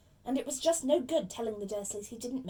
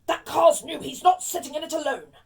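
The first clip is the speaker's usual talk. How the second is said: loud, higher, blustering uncle; indignant objection; 5 semitones higher, 12 dB louder than usual. Acted. Uncle refusing to leave boy in car, indignant. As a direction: object loudly in defence of the new car.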